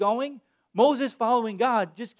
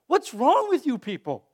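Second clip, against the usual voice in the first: mock exasperated disbelief. Pastor voicing his childhood reaction, mock exasperation. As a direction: throw up your hands at them. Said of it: "my reaction was like this".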